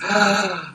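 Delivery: high gasp of surprise; delighted gasp. Real, not acted. Seeing a whole box of plants, delighted gasp. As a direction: gasp at a wonderful find.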